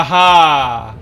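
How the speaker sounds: ordinary talk, nothing marked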